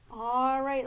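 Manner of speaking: ordinary talk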